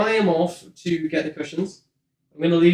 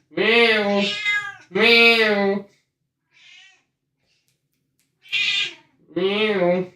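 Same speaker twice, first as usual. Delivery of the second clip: meowing; 5 semitones higher, much slower than usual. Acted. Meowing at the cat.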